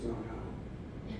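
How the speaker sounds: breathy, hushed "Oh no"; a whispered, shocked mother; mother's faint mock dismay; mock gasp. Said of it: "She goes".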